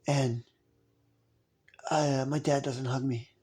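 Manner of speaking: soft, low voice of an awkward sixth-grader; halting hushed delivery with long pauses, like a lonely kid; Steven Seagal as a child. Acted. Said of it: "who talked like this".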